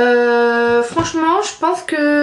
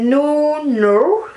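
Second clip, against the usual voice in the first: French accent on English; 3 semitones higher than usual. Real, not acted. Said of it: "moi mon accent pourri".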